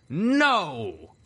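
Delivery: long, high booming denial; blasted shout. A narrator's reading. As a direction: blast out the word like a royal ghoul. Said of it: "the Canterlot Ghoul blasted".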